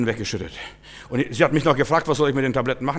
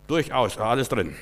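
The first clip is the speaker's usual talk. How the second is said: usual speaking voice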